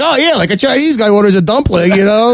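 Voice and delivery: high, mocking impression of the friend; mocking friend's voice. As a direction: squeak it out to tease him. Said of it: "You were like".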